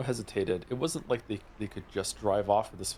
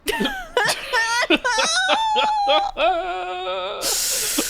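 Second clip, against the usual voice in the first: loud laugh; 7 dB louder than usual. Real, not acted. Players crack up at scene.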